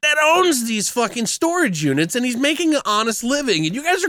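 high-pitched, incredulous rant; worked-up comic protest; exasperated rebuttal. Retold or imitated.

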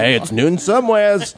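high-pitched mock hollering; jokey proclamation. Host joking about the noon show, mock proclamation. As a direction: pitch it way up as a hammed-up cheer.

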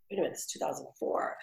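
quick, hushed whisper; whispered aside. Retold or imitated. Realizing the internet is the future, quick whisper to herself. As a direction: whisper it to yourself as it dawns on you.

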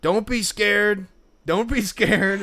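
high, loud, slow coaxing pitch; barking vendor. Retold or imitated. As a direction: coax buyers like a shady hawker. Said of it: "one of the things he goes was like".

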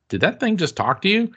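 high-pitched retold disbelief; astonished investigator. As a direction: ask it as if the machine just spoke to you. Investigator astonished at spirit box.

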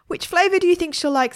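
high-pitched eager question; little boy. A narrator's reading. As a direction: ask like a small boy needing help. Boy asks ice cream man.

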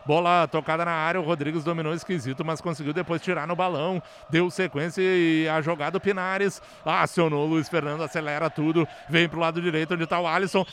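fast, low radio play-by-play. Acted. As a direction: rattle off the move live. Live play-by-play. (narração rápida de lance)

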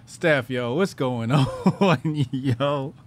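ordinary talk

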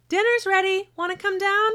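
high loud call from downstairs; calling kids to dinner. Acted. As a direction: call the kid down to eat. Mom calling son to dinner.

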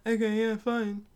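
mildly hurt, giving-in comeback; hurt feelings. Acted. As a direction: lightly act out stung acceptance. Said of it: "when someone hurts your feelings".

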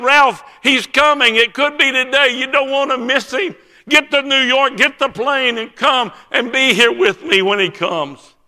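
high, loud, impassioned summons; urgent pleading. Retold or imitated. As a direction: implore a friend at full voice to come now. Friend begs him to come.

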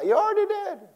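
high teasing jab through laughter; mock them with a laugh; amused high comeback; laughing retort.

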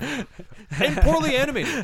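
high, slow, laughing. Real, not acted. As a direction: laugh it out in disbelief. Laughing mockery.

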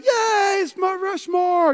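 high, drawn-out mock squeal of delight; excited cheer. Husband imagining wife's first sight, imitated excited cheer. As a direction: imitate a thrilled wife cheering. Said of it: "you know, and all excited".

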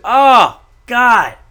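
high, loud exclamation of delight; drawn-out, ecstatic outburst; loud relish. Real, not acted. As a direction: belt it out, savouring the payback. Relishing the imagined comeuppance.

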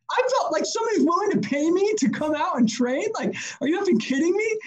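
high-pitched, incredulous delight; stoked. Retold or imitated. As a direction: act out being thrilled beyond belief. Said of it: "I was, like, stoked out of my mind".

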